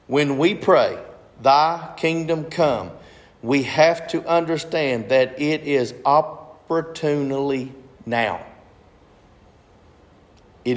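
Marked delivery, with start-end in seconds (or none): 0.1-8.4 slow, deliberate reading, each phrase spaced out; read slowly. Shown as a demonstration. Pastor rereading the verse to his congregation, slowly. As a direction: take it slow so the congregation catches every word. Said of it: "let me, let me read this slowly".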